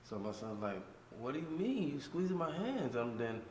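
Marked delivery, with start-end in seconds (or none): 1.2-3.0 high-pitched indignant "what do you mean", same volume; upset disbelief. Retold or imitated. Son objecting to the officer squeezing his hands, high indignant disbelief. Son protests at the police stop.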